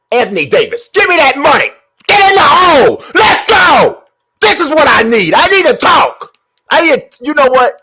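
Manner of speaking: high, loud hyped-up yelling at the game; hype yelling. Real, not acted. Streamer yelling at his player to score. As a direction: pump himself up, shouting.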